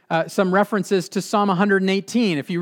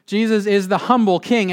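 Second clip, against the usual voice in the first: "humble" hit hard, the line raised in pitch; stress on HUMBLE; 4 semitones higher, 4 dB louder than usual. Shown as a demonstration. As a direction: punch the word "humble". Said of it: "emphasis on humble".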